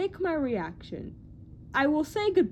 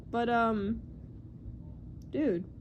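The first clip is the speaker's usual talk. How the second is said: ordinary talk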